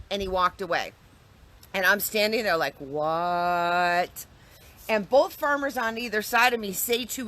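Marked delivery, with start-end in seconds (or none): 2.8-4.3 long, low drawn-out what; stunned disbelief. Retold or imitated. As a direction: stretch it out on one dumbfounded note. Acts out own stunned reaction.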